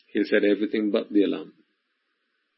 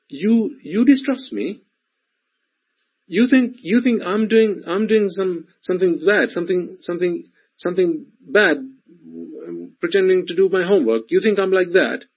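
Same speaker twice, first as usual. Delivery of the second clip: put-on indignant child; 8 semitones higher, 3 dB louder than usual. Child accused of faking homework protests to mother, acted indignation. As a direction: mimic a wronged child, pitched way up. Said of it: "or the child goes".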